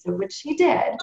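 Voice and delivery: ordinary delivery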